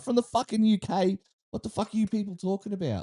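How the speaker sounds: high-pitched mock disbelief; indignant retort. Retelling his reply to a stranger's accent mix-up, indignant. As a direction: act out being affronted, pitched well up.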